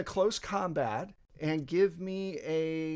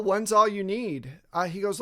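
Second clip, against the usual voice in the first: usual speaking voice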